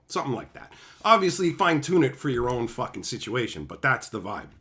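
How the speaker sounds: normal talking voice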